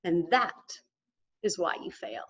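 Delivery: low, soft, half-whispered sage; hushed Yoda verdict. Retold or imitated. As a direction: deliver it like a wise old master. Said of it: "And Yoda looks at him and he says".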